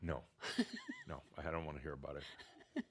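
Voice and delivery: laughing it off; chuckling refusal. Real, not acted. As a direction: laugh while waving it away. Laughs at being asked.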